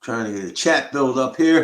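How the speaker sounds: sudden loud outburst. Real, not acted. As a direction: belt it out impatiently. Loud outburst waiting for chat.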